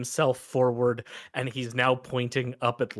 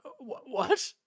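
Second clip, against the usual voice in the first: high, confused stammer; wretched man thrown by the question; baffled crawling man; 14 semitones higher than usual. Acted. Acted beggar baffled by question.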